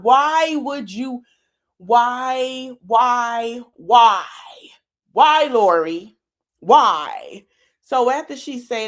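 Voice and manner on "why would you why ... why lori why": drawn-out, higher, insistent whys; imitating a pushy accusing why; mock confrontation. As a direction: hammer the question like a pushy mother. Acting out Lori's confrontation.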